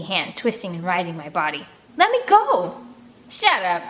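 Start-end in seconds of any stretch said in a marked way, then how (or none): 2.0-2.8 high loud struggling cry; desperate yell. Acted. Captured kid yelling at the woman, desperate. 3.3-3.8 snapped. Acted. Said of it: "she snaps".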